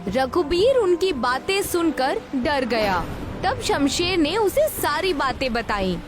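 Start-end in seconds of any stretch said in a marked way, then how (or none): none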